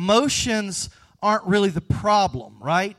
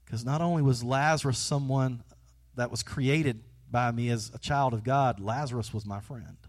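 ordinary talk

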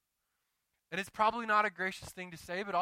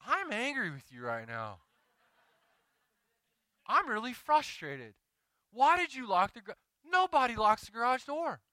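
retold exasperation; 8 semitones higher, 6 dB louder than usual. Telling wife off after lockout.

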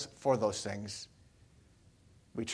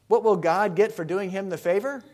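normal talking voice

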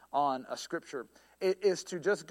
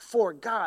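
"for God" punched loud, word by word; stress on each word; 2 semitones higher, 6 dB louder than usual. Shown as a demonstration. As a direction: land firmly on both "for" and "God". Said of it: "I would put emphasis on each word".

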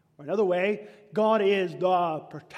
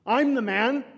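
arrogant boast; 5 semitones higher, 7 dB louder than usual. Acted. Voicing Cain's arrogance.

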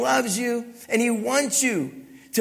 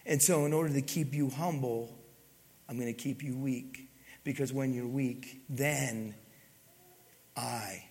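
soft voice of God; 6 semitones lower, 8 dB quieter than usual. Acted. Voicing God's reply to Paul.

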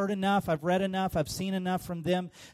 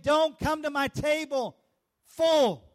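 higher, louder call from the pulpit; preached shout; 8 semitones higher, 5 dB louder, slower than usual. Acted. Pastor voicing Jesus to the disciples, calling out. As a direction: cry it out as Jesus' command.

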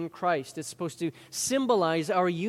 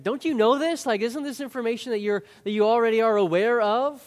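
high, raised, disbelieving question; incredulous Paul; 5 semitones higher, 6 dB louder than usual. Retold or imitated. Paul asking his readers, mock incredulous. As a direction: ask as if it's obvious. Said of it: "he kinda talks like this".

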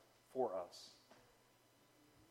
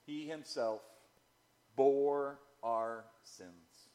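"bore" drawn out very slowly; slow emphatic reading; 4 semitones lower, slower than usual. Shown as a demonstration. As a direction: lean hard on "He himself", stretch "bore our sins". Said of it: "He himself is emphatic".

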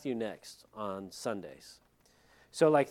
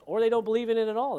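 exasperated burst; 9 semitones higher, 7 dB louder, faster than usual. Acted. Emphatic jab at skeptics.

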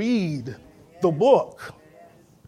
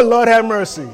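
normal talking voice

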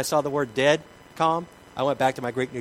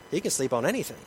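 ordinary talk

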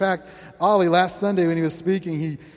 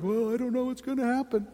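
uncertain shrug; 5 semitones higher, 6 dB quieter than usual. Retold or imitated. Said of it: "some people are like".

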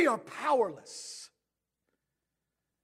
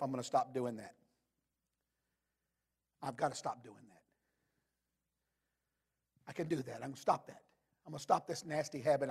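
much softer, as if to yourself; muttered resolutions; 12 semitones lower, 11 dB quieter, faster than usual. Retold or imitated. Preacher imitating someone making private promises, much softer. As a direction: murmur the promises under your breath.

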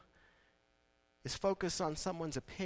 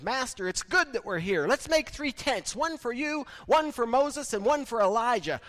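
much higher and louder, eager blurting; loud, rushing eagerness; eager excited Peter; 8 semitones higher, 8 dB louder than usual. Acted. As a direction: blurt it out like an overexcited disciple. Peter's words re-acted, eager and excited.

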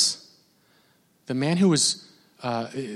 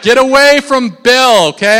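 stern warning; 7 semitones higher, 8 dB louder than usual. Retold or imitated. Handlers warning the fan away, stern and loud. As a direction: order the fan to back off. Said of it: "They're like".

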